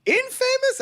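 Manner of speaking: very high, thrilled repeating; delighted. Retold or imitated. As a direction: squeal it with glee. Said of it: "so happy".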